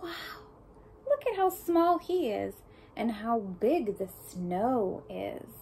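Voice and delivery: plain reading voice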